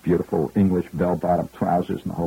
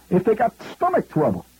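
high, brash Bronx New York voice; Fordham Road Bronx accent; 13 semitones higher than usual. Retold or imitated. Tipsy executive boasting to comic, in Bronx accent. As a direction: drop the English polish, go full Fordham Road. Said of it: "he's talking like he's from Fordham Road".

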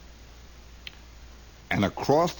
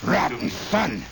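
put-on high hollering old man driving a mule; bossy old father; 4 semitones higher than usual. Father scolding his son while driving a mule, high hollering. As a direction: holler like a tough old patriarch.

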